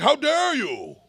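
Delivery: hammed-up imitation of a scandalised critic; mock outrage. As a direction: sneer out the offended reaction. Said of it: "they're like".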